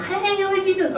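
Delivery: very high mock-sweet greeting; Southern mom. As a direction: answer the phone as a precious Southern mom. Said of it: "Okay, that's my mom".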